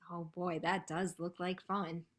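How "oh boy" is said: plain reading voice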